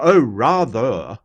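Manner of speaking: slow, drawn-out upper-class English; upper-class English accent. Shown as a demonstration. Streamer showing what sounds posh, upper-class English accent. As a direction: stretch it out like an English toff. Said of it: "very upper class English accent".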